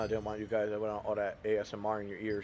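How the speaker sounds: talking while munching a banana; mouth full. Real, not acted. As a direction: speak around a mouthful of food. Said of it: "I'm munching on a banana".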